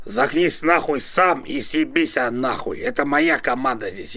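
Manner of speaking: ordinary talk, nothing marked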